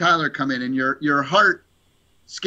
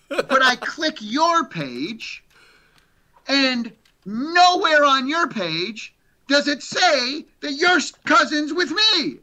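mock complaint; 10 semitones higher, slower than usual. Friend's mock complaint retold.

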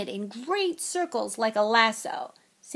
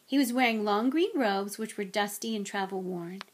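plain reading voice

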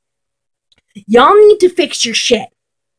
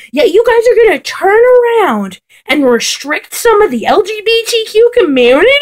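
usual speaking voice

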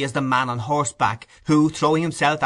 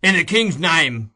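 raised, official demand from outside; louder, higher cry of authority; crowd crying out; 4 semitones higher, 5 dB louder than usual. A narrator's reading. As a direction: call out to those behind a door. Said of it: "cried the voices without".

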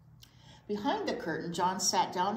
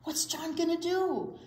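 put-on high eager child's question; curious kid; 12 semitones higher, 5 dB louder than usual. Teacher voicing Andrea asking about John, high eager kid.

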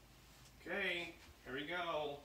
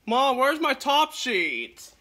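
loud call to another room, slow; yelling across the room; 10 semitones higher, 16 dB louder, slower than usual. Real, not acted. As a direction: shout it out to Mom down the hall. Calling out to mom in another room.

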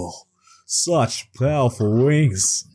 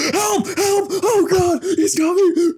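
mock screaming for help; 3 dB louder than usual. Mock kidnapping calling out.

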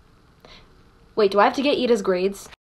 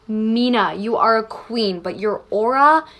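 usual speaking voice

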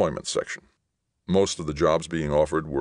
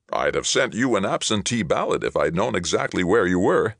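raised, louder jocular delivery; joking; 5 semitones higher, 5 dB louder than usual. A narrator's reading. Mayor teasing the returned young man, joking. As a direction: toss it out as a hearty joke. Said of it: "the mayor joked".

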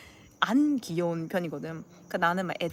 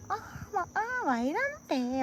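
very high, softer cutesy whine; Gyeongsang aegyo; 11 semitones higher, 3 dB quieter, slower than usual. Acted. Demonstrating real Gyeongsang cuteness, exaggerated high whining. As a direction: stretch it into a babyish plea. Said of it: "막 이렇게 해야지 애교지".

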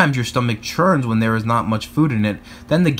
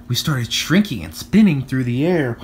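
ordinary delivery

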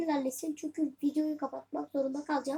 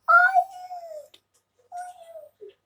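high drawn-out wail, loud then fading; dismayed cry; 13 semitones higher, 8 dB louder than usual. Real, not acted. Gamer reacting to a lost chance, dismayed wail.

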